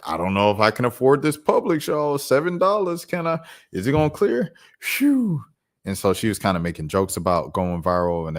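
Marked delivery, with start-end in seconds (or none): 0.0-5.4 high mocking imitation of her fretting over the price; mock worried shopper. Mocking a podcaster worried her card won't clear, high and fretful. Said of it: "and she was like".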